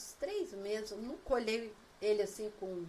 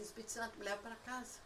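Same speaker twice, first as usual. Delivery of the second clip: softly murmured plea, much quieter; meek retold prayer; 9 dB quieter, faster than usual. Lost driver asking the Holy Spirit for home, meek prayer. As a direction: ask it softly, giving in.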